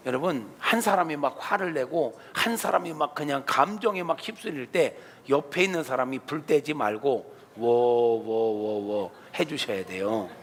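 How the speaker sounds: ordinary talk, nothing marked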